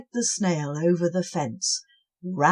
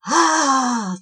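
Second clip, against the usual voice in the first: loud high battle cry; roar; 6 semitones higher, 9 dB louder than usual. Acted. Cobwobblies roaring at the snail. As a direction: roar as they charge. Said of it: "they roared".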